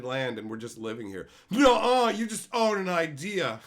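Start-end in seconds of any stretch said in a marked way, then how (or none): 1.5-3.6 high, loud, slow sneering shout; hammed-up taunt; mocking childish retort. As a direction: yell it like a smug child. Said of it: "No, 'cause, like".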